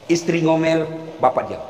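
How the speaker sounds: ordinary talk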